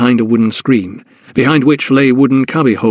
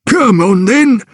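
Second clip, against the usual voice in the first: urging porter; 10 semitones higher, slower than usual. Acted. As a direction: hurry her along, straining. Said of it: "urged the man".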